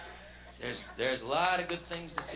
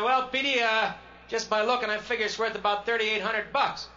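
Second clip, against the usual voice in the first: slick car salesman; 7 semitones higher, 5 dB louder than usual. Retold or imitated. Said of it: "And he said".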